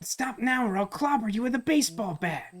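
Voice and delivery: put-on high-pitched, threatening young hero; Luca. Luca threatening the large figure, high-pitched character voice. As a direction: warn off an intruder in a high voice. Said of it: "Luca's voice for some reason by pitching high".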